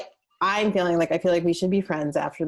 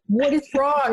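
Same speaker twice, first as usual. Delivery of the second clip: higher, worried husband asking; husband's concern; 8 semitones higher than usual. Retold or imitated. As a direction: ask like a startled husband. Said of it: "He's like".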